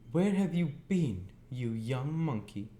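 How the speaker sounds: put-on high, cross scolding of a boy; shrill-voiced sister scolding. A narrator's reading. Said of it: "said Miss Joe, stamping her foot".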